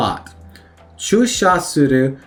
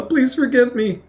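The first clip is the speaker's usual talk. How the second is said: high-pitched mock plea; begging forgiveness; 10 semitones higher than usual. Teacher translates the plea for forgiveness, high pleading voice. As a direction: beg for mercy in a high voice.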